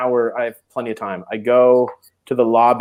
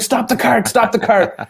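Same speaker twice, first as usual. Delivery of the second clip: higher, louder urgent call; urgent order; 9 semitones higher, 3 dB louder than usual. Retold or imitated. Bride on the cart calling to stop. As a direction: make them stop right now. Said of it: "She's like".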